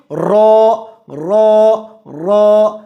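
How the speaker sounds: را repeated, full and heavy with zabar. Shown as a demonstration. Teacher drills heavy ra with zabar. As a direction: say را thick, three times. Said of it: "را پر زبر ہوگی".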